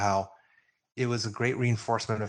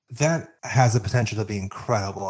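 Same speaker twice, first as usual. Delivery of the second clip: normal talking voice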